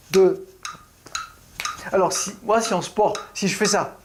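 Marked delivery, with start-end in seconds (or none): 0.1-0.4 "deux" punched louder; accent on the second beat. Shown as a demonstration. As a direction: lean hard on "deux". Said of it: "l'accent, elle est sur".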